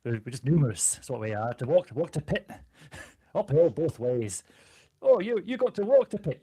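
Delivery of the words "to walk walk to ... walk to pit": broad Yorkshire, pitching up high on walk to pit; Monty Python Yorkshireman boasting of hardship; Yorkshire accent. Shown as a demonstration. Yorkshire dwarf showing off his accent to the stream. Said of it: "us with this accent".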